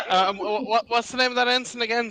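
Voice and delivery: high, loud eager question; suddenly eager to remember; excited. Real, not acted.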